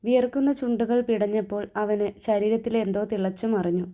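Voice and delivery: ordinary delivery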